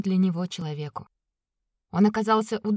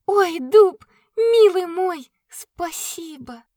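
grateful cry; 10 semitones higher, 7 dB louder, much slower than usual. Acted. Katya thanks the oak.

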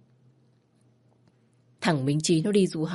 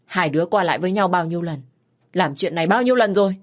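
put-on a frantic mother berating her child; scolding mother; 5 dB louder than usual. Mother scolding her teenage daughter, loud and distraught.

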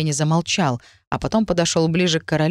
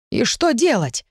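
urgent, higher blurt; 7 semitones higher than usual. A narrator's reading. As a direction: blurt it out in alarm. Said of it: "выпалил Эмбрис".

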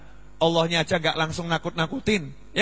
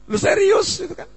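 mock surprise; 14 semitones higher, 5 dB louder than usual. Mimicking audience's surprised reaction.

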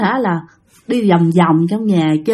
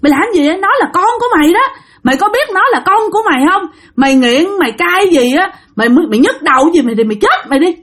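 high, rapid-fire mock telling-off; acted outraged scolding, pitched up without shouting; furious scolding; 8 semitones higher, faster than usual. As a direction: act out a horrified mother berating her daughter. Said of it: "mẹ chửi quá trời luôn đó".

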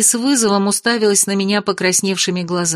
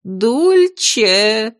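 calling out. A narrator's reading. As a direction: call her name across the house. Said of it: "Бабушка закричала так". (громкий радостный оклик)

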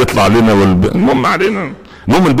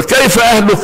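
high mock caliph; 11 semitones higher than usual. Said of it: "قال".

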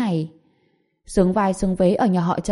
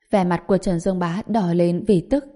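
plain reading voice

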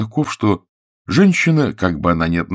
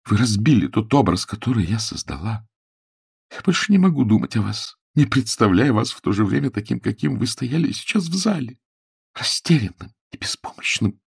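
higher-pitched, wounded reproach; slightly tearful; 5 semitones higher than usual. A narrator's reading. Woman reproaches her fallen idol, slightly tearful. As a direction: play her on the edge of a light sob. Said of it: "Она слегка всхлипнула".

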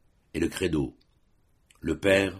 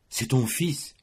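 trembling voice; 5 semitones higher, slower than usual. A narrator's reading. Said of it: "d'une voix tremblante".

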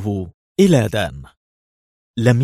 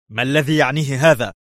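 higher, resentful; irritated; 5 semitones higher than usual. A narrator's reading. The ambassador questions the agent on the phone, irritated. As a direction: push for an answer, annoyed. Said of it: "قال محنقا".

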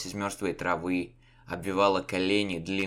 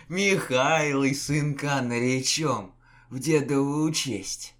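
dreamy tender; 6 semitones higher, 4 dB louder, slower than usual. A narrator's reading. Dying man dreams of son.

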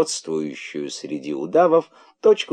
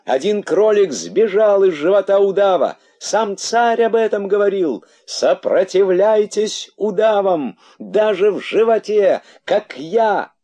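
высокие, громкие протяжные выкрики; вопи как глашатай, высоко; вопль-воззвание; 12 semitones higher, 5 dB louder than usual. A narrator's reading. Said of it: "вопил из его живота". (громко кричит, призывая к сопротивлению)

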